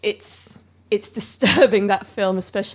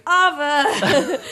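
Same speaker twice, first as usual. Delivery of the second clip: mock announcement; 6 semitones higher, 6 dB louder than usual. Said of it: "neon sign going".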